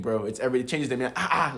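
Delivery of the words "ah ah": higher-pitched reproachful "Ah, ah"; what's-wrong-with-you. Shown as a demonstration. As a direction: demonstrate "Ah, ah" as if asking what's wrong. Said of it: "What's wrong with you?".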